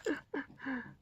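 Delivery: quiet giggle; little breathy giggle; soft high laugh. Real, not acted. Laughs at her drawing.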